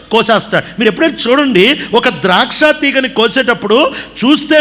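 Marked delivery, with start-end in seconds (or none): none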